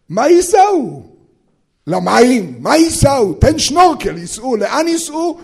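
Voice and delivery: high, loud baffled questions; incredulous questioning. Acted. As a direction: protest an impossible order in disbelief. Acted disbelief at the command. (שאלות נדהמות בקול גבוה)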